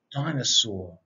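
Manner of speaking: usual speaking voice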